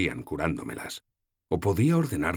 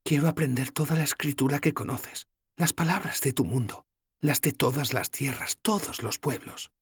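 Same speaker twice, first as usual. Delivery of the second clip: Sefawin's hushed voice; 10 semitones higher than usual. Acted. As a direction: let her longing come through, slowing at the end. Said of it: "susurró".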